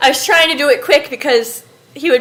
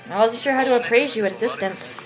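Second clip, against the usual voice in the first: soft, prim appraisal; lightly voiced prim and proper gent; haughty house warden; 8 dB quieter than usual. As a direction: look down on the newcomer politely.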